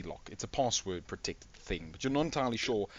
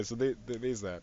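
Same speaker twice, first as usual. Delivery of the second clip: normal talking voice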